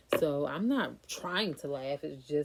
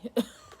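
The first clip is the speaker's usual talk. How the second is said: a quick high burst of laughter; short laugh; 8 semitones higher, 7 dB louder than usual. Real, not acted. Storyteller laughing at her own retort, a quick high laugh. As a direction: laugh it off after the outburst.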